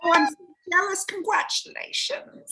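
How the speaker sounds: high, quick and playful envy; playfully jealous gush; delighted gush. Real, not acted. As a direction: gush over the news. Reacts to grandsons news.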